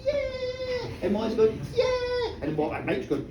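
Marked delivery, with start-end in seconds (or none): 0.0-0.9 very high squeal of joy; a child's high cheer; children cheering. Acted. Wee children cheering at the bottle, high squeal. 1.8-2.4 1 cheer. Acted. Molly cheering.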